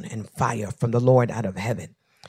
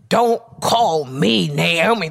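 slow, louder angry retort; 3 semitones higher, 7 dB louder, slower than usual. Retold or imitated. Naomi snapping at her people, angry. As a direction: spit it out, fed up. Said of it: "She was mad".

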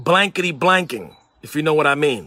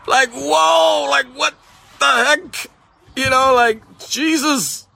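high-pitched, exclaimed mock disbelief; retold shock; 8 semitones higher than usual. Pastor reacting to cursing friends who preach on Sunday, mock shock. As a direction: reel in amused shock, pitched right up.

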